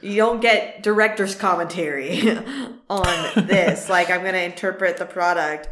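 ordinary talk

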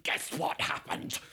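excited stage whisper; retold gossipy whisper. Actor rushes to tell assistant, excited whisper. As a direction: whisper it like juicy news.

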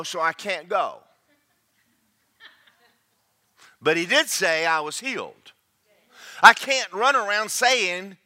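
loud, high-pitched pulpit voice; emphatic sermon, key words hammered loud; impassioned preaching. Real, not acted. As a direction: preach at full force, building to a shout.

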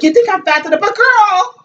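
high-pitched imitation of an offended woman; mock indignation. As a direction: voice climbing high in mock outrage. Acting out the slapping woman's quarrel.

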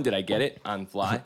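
usual speaking voice

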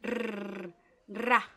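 trilled Rrr then ra; long trilled R; rolled R demo. Shown as a demonstration. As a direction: roll the R hard. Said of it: "especie de temblor".

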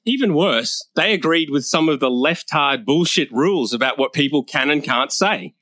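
usual speaking voice

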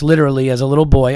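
usual speaking voice

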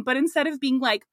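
ordinary talk, nothing marked

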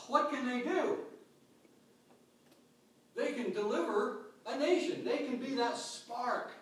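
high, loud rallying preacher's cry; fiery preaching. Acted. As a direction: lift the congregation, build the fire. Preaching crescendo on spark.

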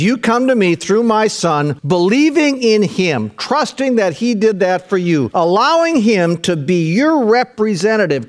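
impassioned divine invitation, preached; God the Father. Acted. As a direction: declare the promise in ringing, measured phrases. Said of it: "Now the Father says".

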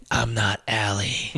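slow, low and very soft; hushed reveal. Retold or imitated. Woman looking up to reveal herself, hushed reveal. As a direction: drop it to a slow, creepy murmur. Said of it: "she looks up and goes".

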